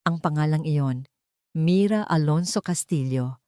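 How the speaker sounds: ordinary delivery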